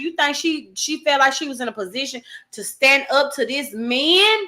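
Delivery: ordinary talk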